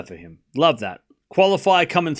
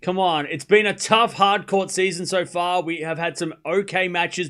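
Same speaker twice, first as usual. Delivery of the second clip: normal talking voice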